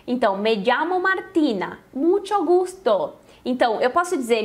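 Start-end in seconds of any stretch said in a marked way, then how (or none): 0.4-3.1 llamo said as dʒamo, slow and clear; slow careful Spanish. Shown as a demonstration. Teacher slowly showing llamo pronunciation to the class. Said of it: "vai prestando atenção na pronúncia".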